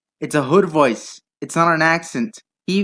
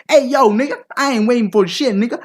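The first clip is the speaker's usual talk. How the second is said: higher and louder aggressive street caricature; 7 semitones higher, 4 dB louder than usual. Retold or imitated. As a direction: yell it like a hammed-up thug threatening the boss. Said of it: "You'll be like".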